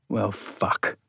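inner thought. A narrator's reading. Philip thinking to himself as he stands, whispered. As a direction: breathe it to yourself. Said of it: "Philip thought".

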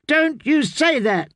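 put-on a child king shouting in fury; boy's sudden shout. Young king shouting at his mother to defend Margaery. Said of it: "Tommen shouted suddenly".

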